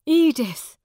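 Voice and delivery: loud, high cry of a name; urgent, pleading shout; desperate call. A narrator's reading. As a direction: call out to the beloved. Miles pleads with his love.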